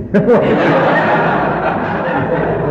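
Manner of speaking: breathy embarrassed laugh at himself; bashful laugh. Real, not acted. Preacher laughing at how scared he was of his mother. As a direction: laugh it off, sheepish.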